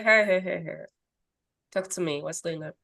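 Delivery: soft, low soothing voice; calming reply. Acted. Calm reply to screaming kids.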